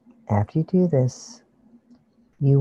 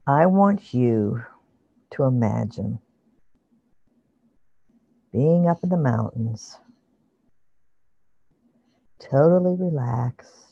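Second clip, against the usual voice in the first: guided meditation. Acted. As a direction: ease them into calm imagery. Leads a guided visualization.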